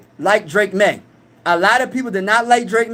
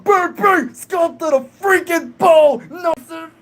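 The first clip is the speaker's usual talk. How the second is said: high, yelling Vikings hype, slower on the chant; hyped outburst; 8 semitones higher, slower than usual. Real, not acted. Streamer hyping the Vikings to chat, high excited yelling. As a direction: lose it for a second and yell for your team. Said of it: "I got hyped for a second".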